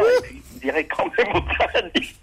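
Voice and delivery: beaucoup plus aigu, cédant à contrecœur; lâche ça en levant les yeux au ciel; exaspéré. Acted. Saint-Pierre exasperated, giving in. (Saint-Pierre cède, exaspéré, voix montée très haut)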